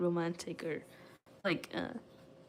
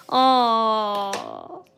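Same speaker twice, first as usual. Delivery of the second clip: ordinary delivery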